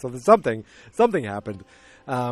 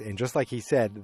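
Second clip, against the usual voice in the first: normal talking voice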